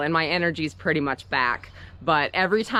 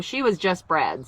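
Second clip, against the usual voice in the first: ordinary delivery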